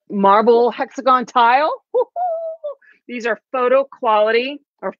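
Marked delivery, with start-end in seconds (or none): 1.9-2.7 very high, drawn-out woo-hoo; gleeful whoop. Real, not acted. Cheering the marble hexagon tile, gleeful whoop. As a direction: whoop in a high singing squeal.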